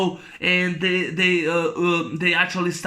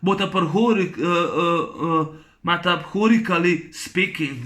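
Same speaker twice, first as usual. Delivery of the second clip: normal talking voice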